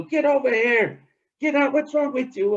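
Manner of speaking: high, loud scolding yell; angry chase. Acted. Trickster yelling at the mud hen he chases, high and loud. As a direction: yell while chasing.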